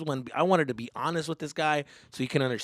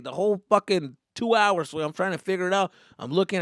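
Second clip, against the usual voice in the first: normal talking voice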